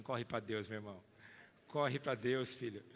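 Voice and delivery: tender. Acted. Urging a brother tenderly.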